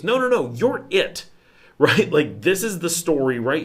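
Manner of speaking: a lightly voiced eager local; playful insistence.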